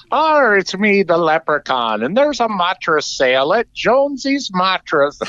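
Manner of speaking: high, hammed-up Irish leprechaun voice in a mattress ad; a cartoon Irish leprechaun pitching mattresses; mock Irish leprechaun accent. Co-host mocks radio ad leprechaun, high Irish accent. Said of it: "I could do an Irish accent".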